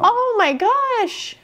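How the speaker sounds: high, drawn-out horrified gasp of words; slow, high-pitched dismay; appalled. Real, not acted. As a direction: stretch it out in pained disbelief. Reacts to child eating dog food.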